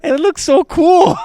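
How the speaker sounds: high, loud gushing shout; excited shout. Retold or imitated. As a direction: gush like a thrilled kid. Excited about the fancy bar.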